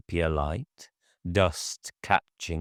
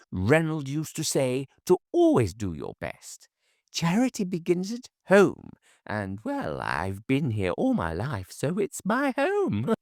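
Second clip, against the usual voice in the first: put-on high elderly lady, rambling gently; a sweet widow reminiscing; kindly old lady; 10 semitones higher than usual. A narrator's reading. Mrs Hines chatting with her neighbour, high and gentle.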